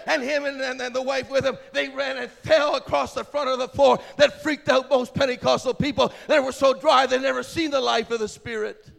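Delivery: loud, driving revival preaching; loud, urgent pulpit delivery; full-force preaching. Real, not acted. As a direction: preach it hard over a crowd.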